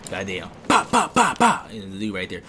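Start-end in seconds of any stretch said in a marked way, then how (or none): 0.7-1.7 mock gunfire. Mock gunfire while shooting.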